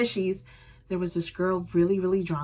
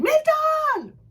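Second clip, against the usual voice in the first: high loud call; 5 dB louder than usual. Retold or imitated. Fan calling out to a singer, loud and high. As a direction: yell his name over the crowd.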